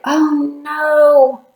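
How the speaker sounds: high, drawn-out cry of dismay; dismayed exclamation. Acted. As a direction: react to a friend's tumble with alarm. Friend reacts to monkey tripping over snake.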